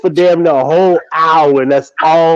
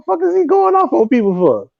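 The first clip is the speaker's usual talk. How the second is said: incredulous; 10 semitones higher than usual. Retold or imitated. Incredulous retelling.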